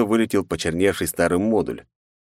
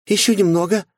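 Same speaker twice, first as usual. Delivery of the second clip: encouraging; 13 semitones higher, 4 dB louder than usual. Acted. Mai urges on the wounded hero. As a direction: cheer him through the pain. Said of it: "подбодрила Май".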